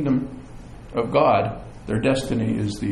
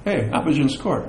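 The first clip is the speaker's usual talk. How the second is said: casual, matter-of-fact remark; note it without any alarm; unworried. Acted. Fan who knows the final score, calmly unbothered. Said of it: "No, no, no, he didn't do that. It's kinda like".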